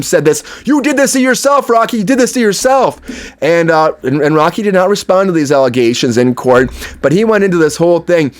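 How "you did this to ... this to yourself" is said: mock shout, raised in pitch but not volume; retold shout. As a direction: imitate an accusing courtroom shout, higher-pitched. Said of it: "Relly was actually in court shouting at him".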